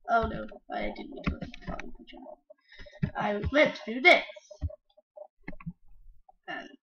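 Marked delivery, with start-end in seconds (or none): none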